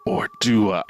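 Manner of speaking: a hammed-up shouted question; mock dramatic. Mocking the dad's camera moment, a hammed-up shout. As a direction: playfully overdo the twist.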